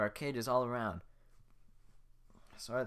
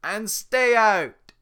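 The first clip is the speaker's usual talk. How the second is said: very loud high drawn-out shout; ogre roar; 11 semitones higher, 20 dB louder, slower than usual. Acted. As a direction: bellow them off the land. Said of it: "He laughs".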